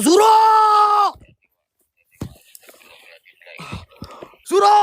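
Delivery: high, loud cry of the name; frightened shout. Real, not acted. Ghost hunter shouts at the pocong Suro, high and loud. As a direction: scream the name at it.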